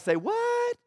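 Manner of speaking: mock stunned, high-pitched "say what?"; incredulous reaction. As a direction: let it soar up high in disbelief. Stunned listeners' reaction acted out.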